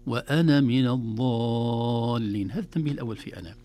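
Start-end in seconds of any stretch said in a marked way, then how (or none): 0.1-2.5 slow chanted وأنا من الضالين, na short; joined, short na. Shown as a demonstration. Chant slowly, na of وأنا short. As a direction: sheikh recites the connected form melodiously. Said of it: "أما في الوصل".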